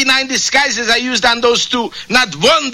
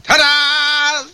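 triumphant; 7 semitones higher than usual. Acted. Villain's grand entrance.

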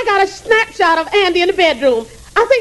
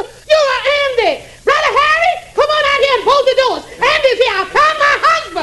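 high-pitched, shouted discovery; excited calling out; 4 semitones higher than usual. Acted. Widow calls her brother on unmasking her husband, frantic. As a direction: cry out to the whole house.

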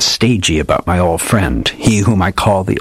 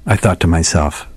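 ordinary delivery, nothing marked

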